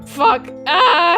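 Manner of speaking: ordinary talk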